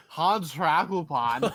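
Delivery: slow hammed-up German accent. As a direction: drag out the name in a thick German accent. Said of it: "We're going to do the rest of this podcast in German accents".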